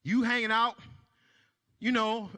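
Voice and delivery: usual speaking voice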